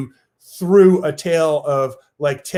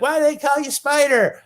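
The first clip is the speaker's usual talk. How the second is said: put-on little kid voice; 11 semitones higher than usual.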